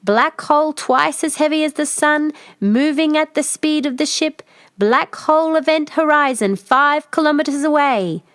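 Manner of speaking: steady machine report; ship computer. Acted. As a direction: read it out like the ship's computer.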